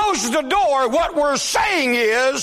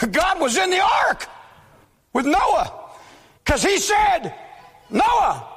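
higher, impassioned preaching; preaching at full force; 5 semitones higher than usual. Acted. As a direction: drive the point home to the congregation.

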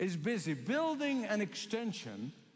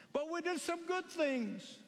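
much higher, begging tone; pleading foolish ones; 8 semitones higher than usual. Acted. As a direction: plead desperately at the closed door.

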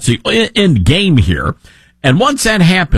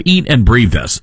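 normal talking voice

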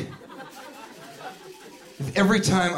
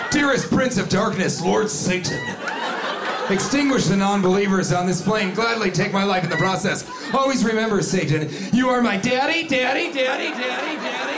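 solemn invocation climbing into a high repeated chant; mock satanic prayer; 5 semitones higher than usual. Mock worshipper calling on Satan, prayer rising to chant. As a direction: build a hammed-up devotion, then fade on the repeats.

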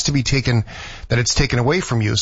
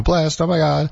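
lightly mocking Valley girl, higher pitch; 6 semitones higher than usual. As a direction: put on a hint of Valley girl. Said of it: "sounds like a Valley girl".

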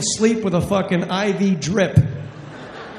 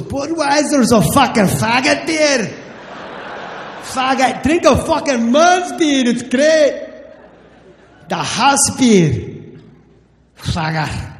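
put-on loud drunk Scottish brogue; Scottish accent; 5 semitones higher, 4 dB louder than usual. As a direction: bark it like a drunk Glasgow barman. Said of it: "He goes".

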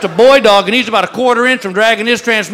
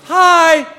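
perky clerk; 5 semitones higher than usual. Retold or imitated. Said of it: "a little girl behind the counter goes".

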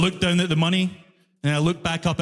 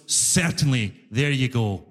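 smug compliance; 6 semitones lower than usual. Retold or imitated. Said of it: "And he goes".

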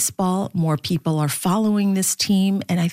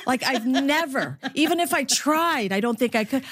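usual speaking voice

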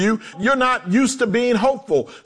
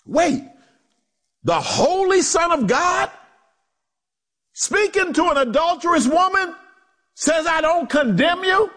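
astonished question, pitched up throughout; incredulous; 8 semitones higher than usual. Acted. Preacher marveling that Jesus pardons an adulteress, incredulous.

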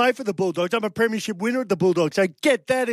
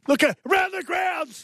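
high, drawn-out segment shout; calling out; 10 semitones higher, slower than usual. Real, not acted. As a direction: holler it like a show cue. Calling the score-update segment cue.

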